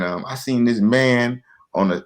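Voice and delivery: usual speaking voice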